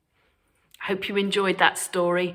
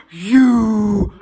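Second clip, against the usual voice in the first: loud triumphant wolf shout; wolf crying out to Granny, loud; wolf's cry; 3 semitones higher, 11 dB louder than usual. Acted. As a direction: pounce with the word. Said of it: "cried the wolf".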